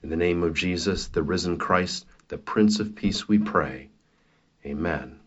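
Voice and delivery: ordinary delivery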